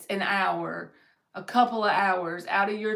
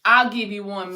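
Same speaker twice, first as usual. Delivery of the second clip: usual speaking voice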